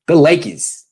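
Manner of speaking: a New Yorker's "Lakers" with the r dropped, "Lakiz"; New York accent. Shown as a demonstration. Host imitating how New Yorkers say Lakers, loud mock New York. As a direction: say "Lakers" like a Knicks announcer, no r at the end. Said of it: "I love how New Yorkers say Lakers".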